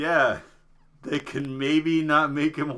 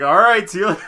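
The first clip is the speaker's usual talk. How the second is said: chirpy mock send-off, high and loud; mock cheerful farewell; 5 semitones higher, 7 dB louder, faster than usual. Mocking Kratos as he dumps the scholar, chirpy. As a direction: sing it out with fake cheer.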